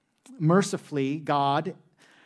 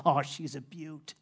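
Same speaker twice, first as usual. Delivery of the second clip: lightly imitated Australian accent, softly admiring a snake; a hint of Australian accent, mock admiration; Crocodile Hunter; 4 dB quieter than usual. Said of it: "say in that cool accent of his".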